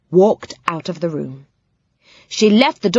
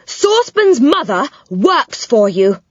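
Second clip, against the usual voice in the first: shouting; 6 semitones higher, 3 dB louder than usual. A narrator's reading. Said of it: "he shouted".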